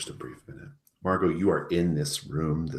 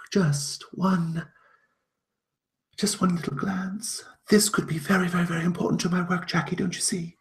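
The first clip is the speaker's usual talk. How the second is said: much higher, fast, then pleading half-whisper; a curious stranger begging for a peek; eager strange woman; 6 dB louder than usual. Acted. Unknown woman begs Adeline for one look, high and eager. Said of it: "another woman's voice".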